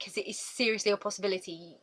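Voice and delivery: usual speaking voice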